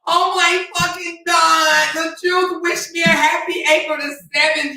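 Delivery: mock a starstruck fan who got a shout-out; hammed-up giddy fan voice; gushing fangirl. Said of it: "she'd be like".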